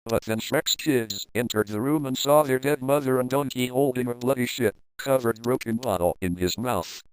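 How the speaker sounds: ordinary delivery, nothing marked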